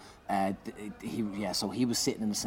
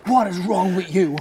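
high, very loud outraged demand; imitated appalled shout; 10 semitones higher, 11 dB louder than usual. Woman looks at the performer on their knees, loud appalled yell. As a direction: yell it in sheer disbelief.

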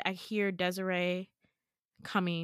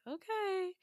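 skeptical okay; 10 semitones higher, 5 dB quieter than usual. Retold or imitated. Jay's doubtful reply, retold.

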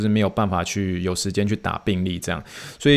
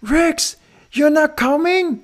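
very high, slow, drawn-out disbelief; astonished coworkers; slower than usual. Retold or imitated. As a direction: stretch it out in mock shock, way up high. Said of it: "很多人就很惊讶".

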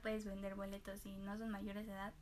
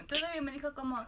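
ordinary delivery

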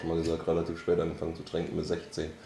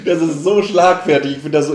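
laughing; 8 semitones higher, 12 dB louder than usual. Real, not acted. Laughs at witty reply.

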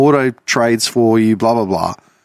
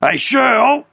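high, drawn-out mock holler; losing it in background; 13 semitones higher, much slower than usual. Old man calling his wife for the cards, high acted yell. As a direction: holler for the wife, strained and slow. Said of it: "I've started losing it in the background".

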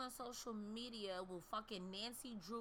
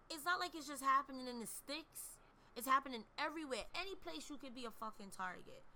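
usual speaking voice

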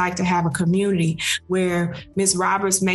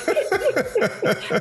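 careful laugh; 12 semitones higher than usual. Real, not acted. Laughing at a joke.

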